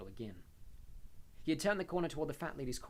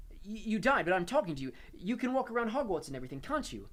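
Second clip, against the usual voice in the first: urgent pleading; 9 semitones higher, 8 dB louder than usual. Acted. Harry pressing the ghost.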